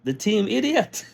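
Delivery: mock disbelief. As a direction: act out laughing amazement at the boast. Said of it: "I was saying to myself".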